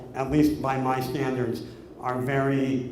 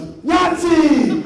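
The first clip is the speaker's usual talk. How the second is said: put-on high, loud gleeful yell of a little boy; kid's excited shout; 13 semitones higher, 9 dB louder than usual. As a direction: shout it like a thrilled four-year-old. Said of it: "and he goes".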